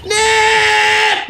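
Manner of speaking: long, high yell of the name; loud shout. Acted. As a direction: yell one drawn-out word at full force. Shouting into sound-triggered camera.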